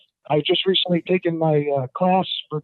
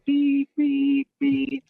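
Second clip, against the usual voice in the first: high, even, drawn-out beeps; steady high-pitched beep imitation; reversing truck beeps; 9 semitones higher, much slower than usual. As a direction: mimic a truck's backup alarm.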